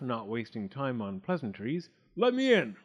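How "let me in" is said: high, loud wolf order; impatient big bad wolf command; barked. A narrator's reading. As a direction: bark the demand at the door. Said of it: "barked the wolf".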